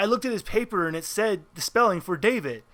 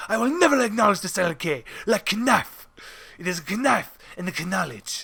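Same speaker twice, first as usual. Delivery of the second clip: defiant mock Spanish accent, every silent K sounded; hammy Spaniard accent with kuh-nife and kuh-nowledge; David. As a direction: refuse proudly in a put-on Spanish accent. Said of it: "that is how fucking David spoke".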